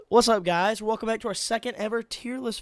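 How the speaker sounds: loud, bright vlog hello; upbeat greeting. Real, not acted. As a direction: kick off the video with energy. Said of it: "vlog greeting".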